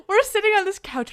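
high laughing burst, then words still laughing. Real, not acted. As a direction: laugh hard at the memory as you speak.

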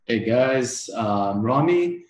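ordinary talk

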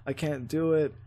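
ordinary delivery

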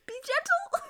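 high, gasping whisper; gasp, then plead in a tiny high voice; hammed-up breathless plea; mock gasped plea. Joking quote of a bitten woman.

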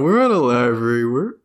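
barely moving the mouth; talking through teeth. Pretend. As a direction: speak with teeth clenched, smiling. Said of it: "you're just talking through your teeth".

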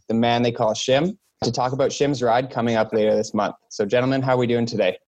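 ordinary delivery

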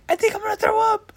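put-on very high, fast kid voice; little kid. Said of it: "one of the kids like".

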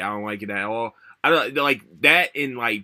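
ordinary talk

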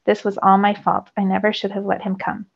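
ordinary delivery

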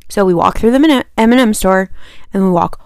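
ordinary talk, nothing marked

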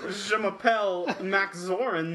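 slow, halting mock French; hammed-up French accent, played for laughs. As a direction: stumble through a French self-introduction. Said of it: "let's try it with a French accent".

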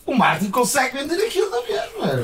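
laughing through the words; high, amused laughing speech. Real, not acted. Laughing about bad products selling.

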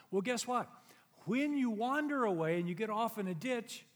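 loud, high preaching voice; full preaching. Acted. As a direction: drive the point home from the pulpit.

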